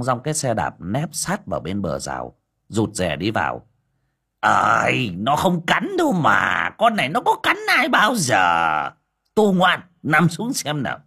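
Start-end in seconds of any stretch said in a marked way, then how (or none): 4.4-11.0 put-on high, loud old man's voice; hearty reassurance. A narrator's reading. Đồng reassures Vó and tells the dog to lie down. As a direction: coax the dog down cheerfully.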